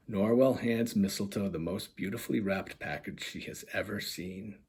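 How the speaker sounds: lively storybook reading voice; animated children's book narration; story narration. A narrator's reading. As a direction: read it like bedtime picture book. Author reads picture book aloud.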